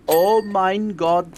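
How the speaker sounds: put-on slow energetic German accent, mein Gott drawn out; Richtofen German accent.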